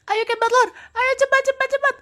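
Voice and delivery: high, loud wails and urgent pleas; panicked screaming. Acted. Frightened player urges Cat Butler to run, panicked screaming. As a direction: scream and hurry a friend along in fright. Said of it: "kok teriak-teriak gitu".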